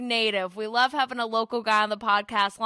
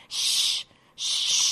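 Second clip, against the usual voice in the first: shushing. Retold or imitated. Mimics golf crowd shushing.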